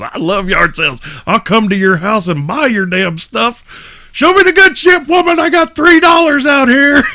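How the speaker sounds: high, loud mock shout; yard sale shout. The comedian yells at a woman at her yard sale, high and loud. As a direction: yell for the good stuff.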